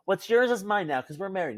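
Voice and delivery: ordinary delivery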